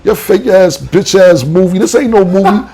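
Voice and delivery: high-pitched angry tirade, retold; heated cussing-out, punching each insult; mad. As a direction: re-enact blowing up at someone. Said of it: "So I got mad".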